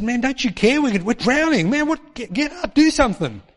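high, frantic plea; panicked plea. Retold or imitated. Disciples begging sleeping Jesus, high and frantic. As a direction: beg him to wake up in panic.

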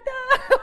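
a short high giggle. Real, not acted. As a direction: giggle brightly. Laughs after an excited quote.

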